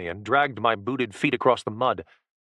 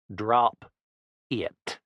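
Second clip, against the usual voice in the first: snarled order. A narrator's reading. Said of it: "The bumpkin spoke in a snarl".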